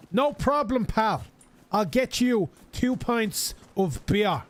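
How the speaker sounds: high, slow barman talking for a tourist; slow tourist English. Retold or imitated. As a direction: stretch it out for the foreigner. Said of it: "slowing down their English tune".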